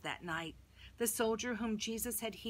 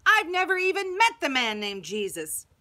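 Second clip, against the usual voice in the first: roaring; 7 semitones higher, 8 dB louder than usual. Acted. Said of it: "I cursed and I swore and I roared".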